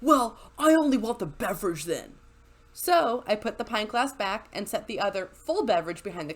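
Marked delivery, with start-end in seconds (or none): 0.0-2.1 huffy, petulant customer voice. Retold or imitated. Bartender mimics a huffy customer giving up the pint glass, sulky. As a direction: pout it out in a sulk. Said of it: "gets all huffy and puffy and goes".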